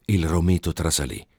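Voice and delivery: usual speaking voice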